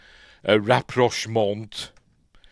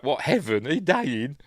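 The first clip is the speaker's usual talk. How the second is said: mock incredulous; 6 semitones higher than usual. Imagined startled reply to grandfather.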